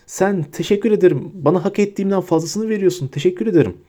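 high, wheedling rat-man voice; cringing Pettigrew plea. A narrator's reading. Pettigrew grovels to Hermione, high wheedling plea.

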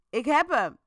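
loud triumphant call; a cop calling out he's found him; agent shouting. A narrator's reading. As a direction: shout it like you caught him. Agent shouts from back door.

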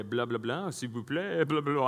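gibberish imitation of French chefs; badly faked French patter; mock French. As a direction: babble in pretend French. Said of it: "I don't speak French".

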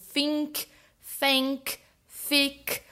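"think", "thank", "thick" with a strong F in place of TH, like "fink", "fank", "fick"; Brazilian-style F for TH in "think", "thank", "thick". Shown as a demonstration. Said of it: "esse T H com um sonzão forte de F".

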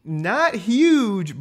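slow, high reveal of news; emphatic reveal. Real, not acted. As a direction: land the surprising number. Revealing surprising stat.

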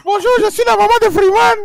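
hammed-up Ivorian mom calling school; accent ivoirien exagéré, voix aiguë; mock Ivorian accent mother. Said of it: "Ça, c'est un accent ivoirien".

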